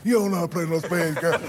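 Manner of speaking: playful card-table taunt; teasing mom. Retold or imitated. Moms trash-talking at spades.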